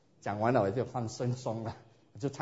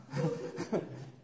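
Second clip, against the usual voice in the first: breathy laugh; 3 semitones higher, 8 dB quieter than usual. Real, not acted. Laughs at unreadable Bible names.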